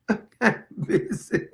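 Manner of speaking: giggling through the line; can't hold it together, laughing; repeating the joke while cracking up. Real, not acted.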